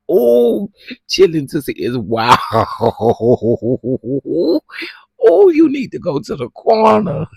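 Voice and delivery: loud laughing through the words; loud, laughing scold. Real, not acted. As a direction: crack up while scolding.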